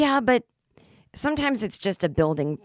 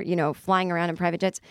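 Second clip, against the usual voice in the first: normal talking voice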